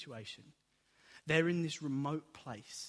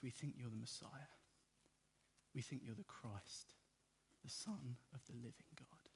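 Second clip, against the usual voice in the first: very soft, solemn confession; hushed, serious; 3 semitones lower, 13 dB quieter than usual. Acted. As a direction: drop to a near-whisper and mean it. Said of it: "Peter gets serious".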